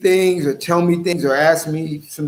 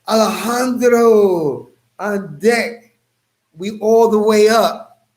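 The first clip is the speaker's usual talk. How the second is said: drawn-out, higher announcer call; announcing call-out; 5 semitones higher, 3 dB louder, slower than usual. Real, not acted. Host hailing viewers as they come on deck, drawn-out call. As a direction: hail them with long stretched vowels.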